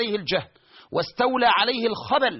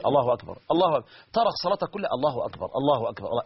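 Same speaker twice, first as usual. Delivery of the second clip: lower, anxious repeated takbir; obsessive worshipper; 6 semitones lower, faster than usual. Retold or imitated. Obsessive worshipper restarting his prayer, lower anxious takbir. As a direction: restart the takbir again and again, unsure.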